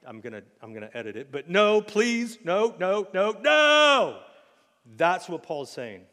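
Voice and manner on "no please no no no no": louder, higher, slow pleading no; Michael Scott's pleading no rising to a scream. Retold or imitated. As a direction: beg it, rising into a wail. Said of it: "Michael Scott".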